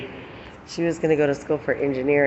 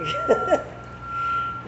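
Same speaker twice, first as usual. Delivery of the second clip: breathy laugh. Real, not acted. Laughing at herself.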